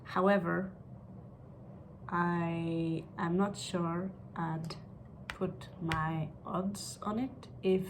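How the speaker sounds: usual speaking voice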